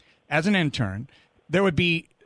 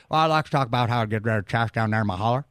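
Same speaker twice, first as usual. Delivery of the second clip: much lower, fast Tennessee drawl; Southern drawl caller; 7 semitones lower, faster than usual. Retold or imitated. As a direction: rattle it off like a backwoods Tennessee caller. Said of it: "get out of our southern draw".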